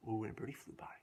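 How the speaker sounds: gasp, then a soft whisper; hushed gasp. Real, not acted.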